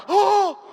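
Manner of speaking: loud, high gasp of discovery; high excited "oh!". Retold or imitated. Fan spotting the handler's trick, high excited cry. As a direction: squeal "oh" as if catching on.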